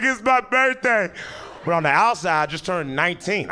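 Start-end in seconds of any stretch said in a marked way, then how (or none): 0.0-1.1 high, drawn-out mock wail; comic inner cry of self-pity. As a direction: whine it slowly, high-pitched and hammed-up. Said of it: "on the inside, I was like".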